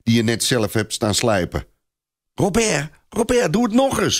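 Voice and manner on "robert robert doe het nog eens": put-on high, excited girl's cry; excited call. A narrator's reading. Thérèse urging Robert to do it again, excited. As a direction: call out eagerly, pitched high. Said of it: "roept Thérèse opgewonden".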